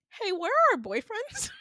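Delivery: high, loud, alarmed question; mock alarm. Asking friends where the boyfriends are, in high mock alarm. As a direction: pitch it way up in hammed-up concern. Acted demand about missing boyfriends.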